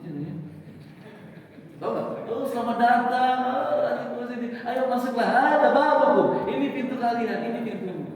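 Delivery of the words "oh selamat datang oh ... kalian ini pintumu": high, bright greeting at the door; welcoming host. Acted. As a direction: welcome them in warmly like a gatekeeper. Angels welcoming into paradise, acted. (sambutan ramah penjaga pintu surga)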